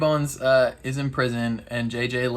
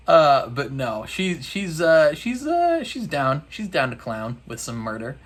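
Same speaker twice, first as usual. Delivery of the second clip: usual speaking voice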